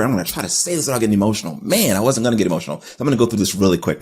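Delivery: fast, pushing through emotion; rushing to hold back tears. Real, not acted. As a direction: hurry through it so you don't break. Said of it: "My speed's gonna speed up".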